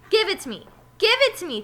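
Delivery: loud, high, insistent demand. Acted. Terrence demanding the ball from Lewis, loud and insistent. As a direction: insist loudly, like a greedy kid. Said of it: "Terrence repeated".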